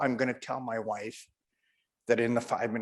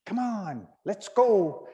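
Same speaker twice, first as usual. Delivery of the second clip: higher-voiced rabbit calling back; teasing, high-pitched call over the shoulder; taunting rabbit; 7 semitones higher, slower than usual. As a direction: egg the chasing dog on. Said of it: "He's looking back every once in a while".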